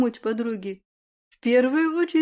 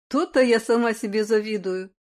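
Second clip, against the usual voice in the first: plain reading voice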